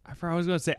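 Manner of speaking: ordinary delivery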